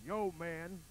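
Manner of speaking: deep burly growl, chest voice; low manly growl. Acted. Burly biker greeting the maiden, low growl. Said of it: "Spike growled in a low and manly, burly voice".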